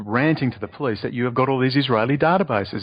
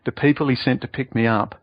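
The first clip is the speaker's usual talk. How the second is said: ordinary talk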